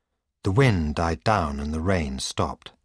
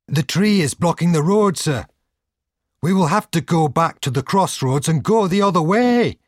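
high, loud shout; shouted warning; 10 semitones higher, 6 dB louder than usual. A narrator's reading. Coachman to his master at a blocked road, shouting. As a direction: call out urgently over a storm. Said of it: "he shouted".

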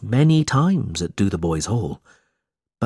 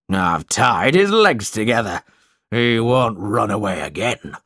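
lightly voiced cruel schoolmaster, pleased with himself; a hint of a gloating headmaster; gloating cry. A narrator's reading. Squeers brings back the runaway boy, mild gloating. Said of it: "cried Mister Squeers".

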